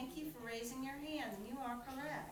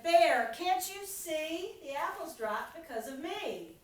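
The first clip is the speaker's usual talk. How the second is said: wind's voice; 5 semitones higher, 8 dB louder than usual. A narrator's reading. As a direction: puff up as the proud wind. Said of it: "The wind said".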